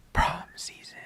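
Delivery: breathy dramatic whisper. Acted. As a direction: whisper it like a secret.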